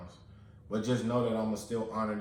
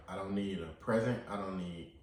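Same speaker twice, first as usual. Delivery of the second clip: ordinary talk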